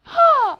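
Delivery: loud, high gasp of discovery; dramatic gasp. Acted. Teacher feigning discovery for the class, big dramatic gasp. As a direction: gasp as if struck by revelation.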